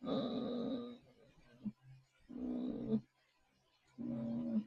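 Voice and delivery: tiny high hums, spaced out; faint acted whimper. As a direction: whimper very softly, as if straining in vain. Acting someone straining through the screen.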